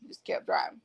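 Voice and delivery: whispered, trailing away to herself. Real, not acted. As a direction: breathe the words out, no voice. Repeats line, second whispered.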